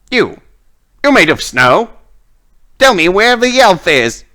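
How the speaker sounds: scornful, then rising to a demand; Lilith. Acted. Witch gloating over a fool, then questioning the golem, high and imperious. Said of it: "you can hear Lilith's voice".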